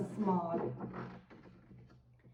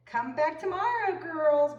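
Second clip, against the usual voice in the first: high, louder friendly call; grinning agent; 7 semitones higher, 5 dB louder than usual. A narrator's reading. Agent sends the girls off, high and grinning. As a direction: grin through it like a sly host. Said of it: "grinned Mister Lamb".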